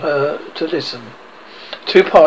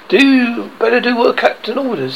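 higher, lightly nervous pirate; 7 semitones higher than usual. A narrator's reading. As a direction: read with a touch of nervous urgency. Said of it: "said Starkey nervously".

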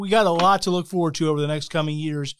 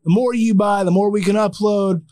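ordinary talk

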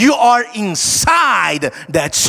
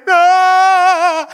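mock fright cry, higher and louder; startled gasp; 7 semitones higher, 5 dB louder than usual. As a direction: yelp like a startled newborn. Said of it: "you got such a fright, you went".

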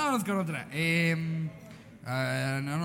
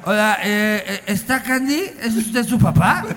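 prank caller; 7 dB louder, slower than usual. Pretend. Prank call voice, loud laughing.

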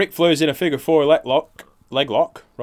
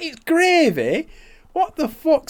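mock disbelief; 4 dB louder, slower than usual. Boss voiced in retold prank.

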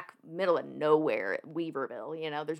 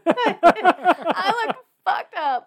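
slow and much higher, still laughing; 12 semitones higher, 6 dB louder, much slower than usual. Real, not acted. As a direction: drag it out through laughter.

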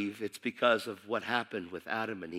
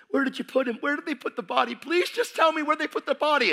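pleading Mary; 13 semitones higher, 3 dB louder than usual. Acted. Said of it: "she says".

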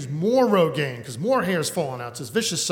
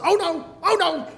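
panicked mother; 12 semitones higher than usual. Retold or imitated. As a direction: squeal it like a horrified mother. Mother panicking at bald spot.

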